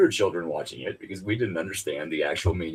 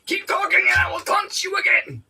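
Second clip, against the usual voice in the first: taunting Frenchman. Acted. Quoting Monty Python French taunter.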